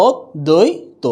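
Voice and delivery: অদ্বৈত slowly as "od... doi... to"; syllable-by-syllable demo. Shown as a demonstration. Teacher answering the pronunciation question, slow syllable demo. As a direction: separate the syllables of অদ্বৈত, doubled d. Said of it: "উচ্চারণ হয়ে যাচ্ছে কি".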